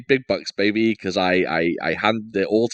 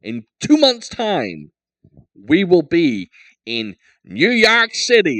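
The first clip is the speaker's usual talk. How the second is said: put-on slow, drawn-out New York accent, higher and louder; 5 semitones higher, 4 dB louder, slower than usual. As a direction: play a hammed-up New Yorker announcing the trip. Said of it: "Is that a good impression?".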